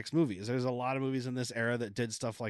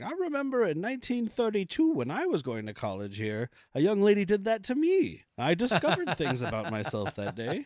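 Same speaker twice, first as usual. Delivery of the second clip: a lightly voiced old lady, higher and wistful; old librarian; 5 semitones higher than usual. Old librarian reminiscing to Eddie, lightly voiced old lady.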